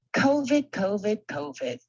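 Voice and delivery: mock exasperated sibling whine, lightly done; Marsha Brady voice. Mocking the pandemic in a light Marsha Brady whine. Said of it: "in my best Marsha Brady voice".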